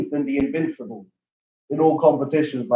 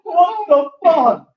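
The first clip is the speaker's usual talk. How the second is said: shocked; 13 semitones higher, much slower than usual. Real, not acted. Reacting to the play.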